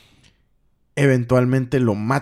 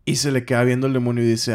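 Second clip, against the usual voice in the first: ordinary delivery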